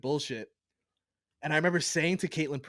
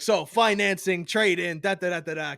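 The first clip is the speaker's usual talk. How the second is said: pushy car salesman; 3 semitones higher, 6 dB louder than usual. Retold or imitated. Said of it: "The guy's like".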